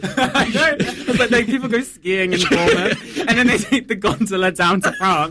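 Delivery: high, loud, giggly absurd riffing; laughing. Real, not acted. Co-host riffing on skiing in Bournemouth, laughing. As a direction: barely hold back the laughter while spinning the joke.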